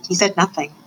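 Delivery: ordinary delivery, nothing marked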